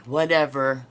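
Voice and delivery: loud, dismissive "Whatever." thrown out; disrespectful whatever. Shown as a demonstration. Showing viewers the rude way to say it, loud and dismissive. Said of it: "That's a very disrespectful word".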